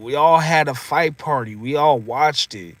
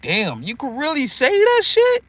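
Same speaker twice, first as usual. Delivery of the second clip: high, amazed squeal of disbelief; can't believe he said it on TV; 10 semitones higher than usual. Retold or imitated. Disbelief at Mike on TV.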